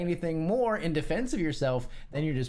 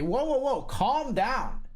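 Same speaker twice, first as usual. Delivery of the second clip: higher, hammed-up patronizing whoa; mock calm down; 9 semitones higher, slower than usual. To an upset human being, hammed-up patronizing. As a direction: play the smug guy soothing someone he provoked. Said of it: "a human being who is upset and say".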